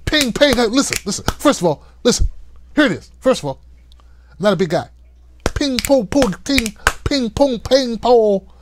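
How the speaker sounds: lightly voiced Kevin Hart, chattering ping pong; Kevin Hart impression. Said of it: "That was my best Kevin Hart impersonation".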